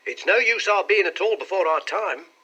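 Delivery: animated clerk. A narrator's reading. Clerk advising his companions on timing, animated.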